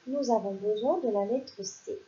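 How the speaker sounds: ordinary delivery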